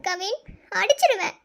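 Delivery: pleading through tears; tearful. Acted. Wife longing for absent husband to return, tearful. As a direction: scold him, then plead, sniffling between phrases. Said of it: "எதுக்கு அழுதுட்டே இருக்க?".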